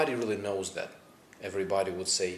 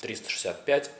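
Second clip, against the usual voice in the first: ordinary delivery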